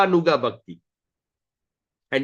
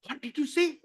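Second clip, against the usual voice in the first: shocked; 9 semitones higher than usual. Acted. Everybody got a shock.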